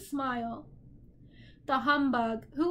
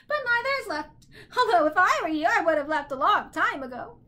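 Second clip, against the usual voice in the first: high squawk growing louder; heckling bird; 5 semitones higher, 4 dB louder than usual. A narrator's reading. Bird character heckling Milo.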